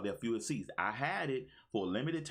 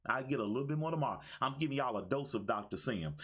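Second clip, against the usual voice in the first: ordinary talk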